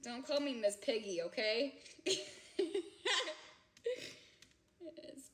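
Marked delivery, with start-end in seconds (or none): 1.8-3.5 long high cackle, half crying; high laughing fit. Real, not acted. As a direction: let the laugh break high. Laughing after the Miss Piggy joke.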